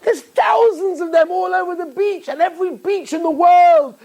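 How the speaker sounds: skeptical passerby. Retold or imitated. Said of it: "He said".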